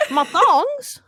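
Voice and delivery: high, incredulous questioning. Retold or imitated. Baffled at being shushed about thongs, loudly incredulous. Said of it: "I, like, speak louder so everyone starts to hear me".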